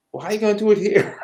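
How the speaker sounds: quick, high-pitched retold challenge; challenging. Wife pushing husband to leave Canada, challenging. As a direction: imitate a partner pressing the point, fast.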